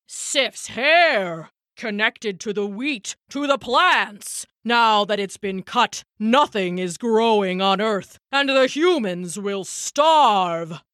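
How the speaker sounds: grand, put-on father-god voice; furious Odin. Odin seeing the barren Earth, grand alarmed voice. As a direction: proclaim the disaster, drawing out the key words. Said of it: "with a fury that rivaled Thor's".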